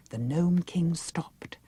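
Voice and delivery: plain reading voice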